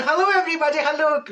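sung-out, hammed-up hello; cheery greeting. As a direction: greet your adoring crowd. Greeting waving pensioners.

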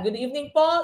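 usual speaking voice

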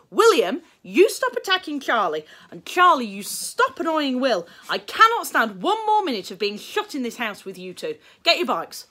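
high, loud scolding; exasperated mother. Acted. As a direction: lay down the law to both. Mother scolding both boys.

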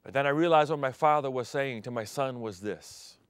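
ordinary talk

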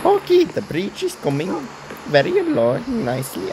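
swooping, high-pitched attempt at a Mario accent; bad Mario accent. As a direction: try a silly Mario accent and botch it. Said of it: "I tried doing a Mario accent".

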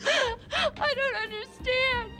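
slow high weeping words; sobbing. Acted. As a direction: cry through each word. Woman sobs after being fired.

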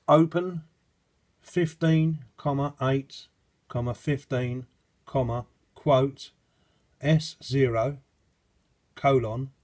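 slow, loud, spelled out word by word; dictating. Shown as a demonstration. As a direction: read the command out slowly and clearly. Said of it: "typed in the command".

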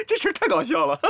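high, loud, laughing through words. Acted. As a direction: laugh while saying it. Laughing at vet joke.

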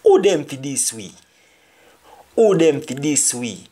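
Jamaican patois example sentence, said twice; teacher demo in Jamaican patois. Shown as a demonstration. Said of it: "Who are they to disrespect us?".

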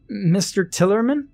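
put-on high-pitched child's call, rising; shouted. A narrator's reading. Ernest calls at the back door, shouting. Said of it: "little Ernest shouted".

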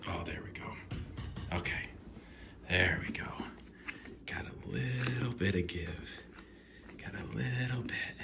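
soft relieved whisper. Real, not acted. Whispering to himself as the box gives. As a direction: breathe it out quietly.

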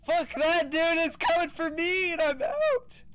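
panicked high yelling; being chased. Real, not acted. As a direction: yell in terror while fleeing. Chased by ghost, shouting.

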